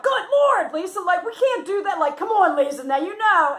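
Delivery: high, loud, laughing disbelief; exasperated protest. Retold or imitated. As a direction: re-enact protesting to an outrageous friend. Reenacts her protest to friend.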